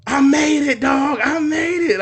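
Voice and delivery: high, loud triumphant yell; joyful shout. Retold or imitated. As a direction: shout it out like you just won. Dad's joyful outburst.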